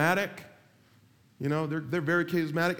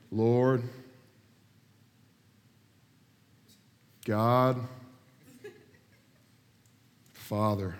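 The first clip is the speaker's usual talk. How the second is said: low, solemn words with very long pauses; mock a ponderous pray-er, leaving huge gaps; long-winded radio prayer; 5 semitones lower, much slower than usual. Imitating pastor praying on the radio, long-winded. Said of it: "This guy prayed for seventeen plus minutes".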